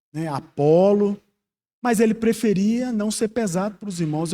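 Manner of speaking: ordinary talk, nothing marked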